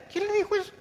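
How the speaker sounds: high incredulous question; mock indignant surprise. Preacher scoffing at worldly wisdom, high and incredulous. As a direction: act shocked at the advice.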